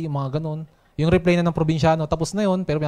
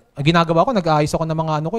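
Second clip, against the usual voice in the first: ordinary talk, nothing marked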